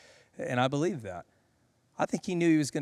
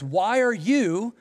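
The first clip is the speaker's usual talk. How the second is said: voicing Jesus; 9 semitones higher, 4 dB louder than usual. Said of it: "He says".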